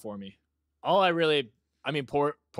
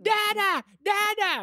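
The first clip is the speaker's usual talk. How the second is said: put-on high, excited little-kid call of "Dada"; toddler calling dad; 4 dB louder, slower than usual. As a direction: squeal it like a three-year-old running over. Imitating toddler running to dad.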